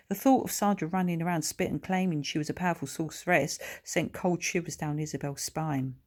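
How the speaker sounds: ordinary delivery, nothing marked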